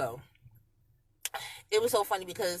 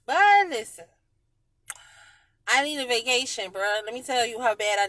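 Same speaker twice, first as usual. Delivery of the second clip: exclaiming; 6 semitones higher, 10 dB louder than usual. Real, not acted. Exclaiming need for vacation.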